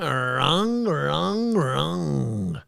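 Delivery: mocking imitation. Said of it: "Why are you doing".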